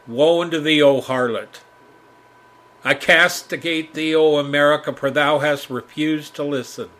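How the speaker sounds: loud archaic prophetic denunciation; mock biblical prophet. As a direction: thunder like an Old Testament prophet.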